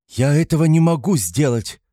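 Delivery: high, loud, wounded protest; offended young woman raising her voice; offended cry. A narrator's reading. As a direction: cry out in hurt indignation. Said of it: "вскричала обиженная девушка".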